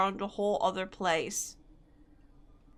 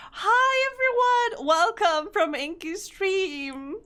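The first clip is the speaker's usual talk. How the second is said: excited welcome; 9 semitones higher than usual. Real, not acted. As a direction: stretch out a bright welcome. Greeting raiders.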